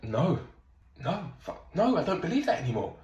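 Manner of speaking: high surprised no, no; realising mid-sentence, pitch up; sudden realisation. Retold or imitated.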